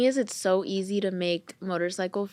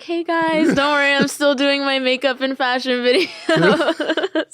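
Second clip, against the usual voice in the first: bright higher story-post voice, laughing through it; mock influencer voice; 7 semitones higher, 6 dB louder than usual. Influencer mimicking her own story post to followers, laughing. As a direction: play the cheery influencer reassuring fans. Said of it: "I had to post on my story, like".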